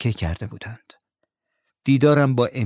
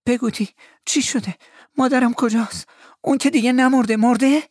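high-pitched panic, growing louder; terrified; 3 dB louder than usual. A narrator's reading. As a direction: plead for answers in rising alarm, very high. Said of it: "کاملاً وحشت زده گفتم".

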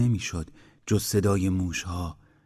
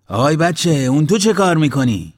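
put-on loud, raised-pitch call to a child; shouting; 7 semitones higher, 9 dB louder than usual. A narrator's reading. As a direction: shout sharply across the water. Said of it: "کدخدا داد زد". (با داد و فریاد)

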